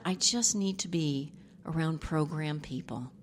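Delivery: retold plea in a soft whisper; hushed, tearful request. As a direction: breathe it out like a daughter's hushed plea. Daughter's plea retold softly.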